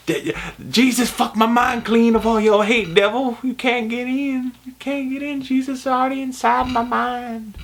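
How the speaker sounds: hammed-up devil rebuke; mock holy defiance; church lady rebuking the devil. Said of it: "quoting the scene again".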